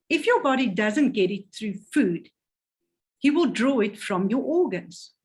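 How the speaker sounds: usual speaking voice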